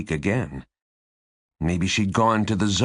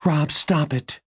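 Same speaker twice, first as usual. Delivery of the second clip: higher-pitched urgent order; urgent intervention; 8 semitones higher than usual. A narrator's reading. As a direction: cut in to stop him, voice raised in pitch.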